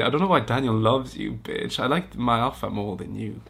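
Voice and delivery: usual speaking voice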